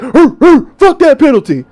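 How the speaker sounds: growly dog grunts then the line; imitating the dog agreeing; hammed-up dog echoing its owner. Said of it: "The dog is like".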